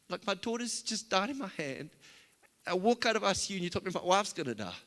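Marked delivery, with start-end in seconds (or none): none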